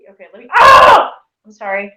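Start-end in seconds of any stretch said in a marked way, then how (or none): 0.6-1.1 sudden high loud yelp; startled scream; startled shout. Real, not acted. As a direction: yelp at another splash of corn juice. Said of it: "I'm sorry, I did it again".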